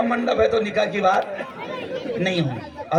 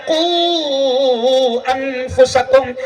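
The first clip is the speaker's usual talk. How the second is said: slow, drawn-out Arabic recitation; قرآنی آیت کی دھیمی تلاوت; Quran recitation; 4 semitones higher, 3 dB louder, much slower than usual. Acted. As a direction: intone the verse with reverence.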